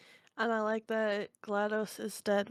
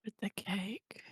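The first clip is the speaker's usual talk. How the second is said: whisper; 8 dB quieter, slower than usual. Real, not acted. Quiet joking aside.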